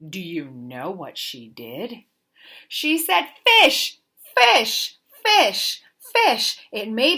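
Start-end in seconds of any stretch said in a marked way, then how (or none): none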